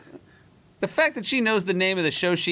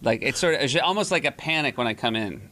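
normal talking voice

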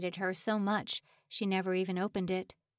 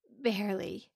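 ordinary delivery

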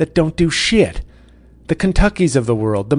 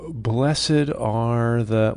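drawn-out preacher; much slower than usual. Acted. Slow drawn out Beatitude.

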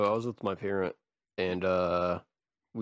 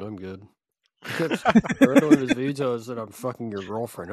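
ordinary delivery

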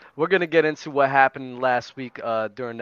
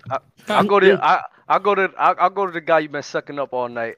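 loud callout; 5 semitones higher, 7 dB louder than usual. Real, not acted. Loud mock callout to a friend.

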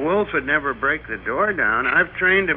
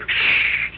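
shushing. Acted. Hushing so boss won't hear.

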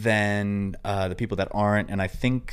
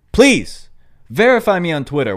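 louder, higher plea; pleading; 9 semitones higher, 8 dB louder than usual. Real, not acted. Begging Twitter to verify him, loud and pleading. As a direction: beg loudly and quickly.

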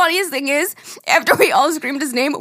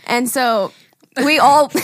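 normal talking voice

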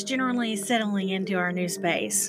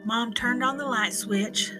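plain reading voice

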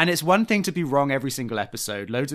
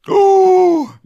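very high, loud mimicked "ooh"; hammed-up howl of fake dismay; mock shocked wail; 13 dB louder than usual. Joining in the breakfast impression, a high mock wail.

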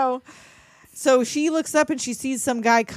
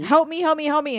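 mock pleading cries; 7 semitones higher than usual. Sister locked out begging to be let in, mock desperate pleas. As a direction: beg frantically to be let in.

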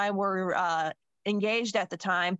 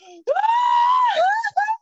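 wailing gasp; 9 dB louder than usual. Retold or imitated. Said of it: "completely losing their damn mind".

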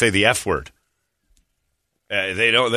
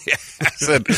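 ordinary talk, nothing marked

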